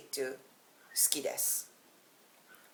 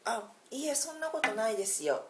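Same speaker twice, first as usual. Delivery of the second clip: humble reply; 7 semitones higher, much faster than usual. Shown as a demonstration. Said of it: "I say".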